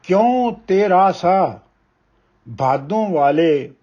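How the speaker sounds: measured, weighty reading of a Punjabi poem; ਕਵਿਤਾ ਪਾਠ ਦੀ ਠਹਿਰਾਵ ਵਾਲੀ ਅਵਾਜ਼; poem recitation. Acted. Poet recites poem Hussar.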